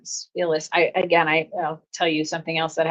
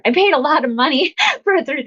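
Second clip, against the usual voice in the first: laughing; 10 semitones higher, 6 dB louder than usual. Real, not acted. Laughs about therapy costs.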